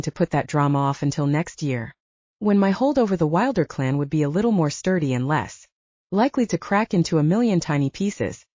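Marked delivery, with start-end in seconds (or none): none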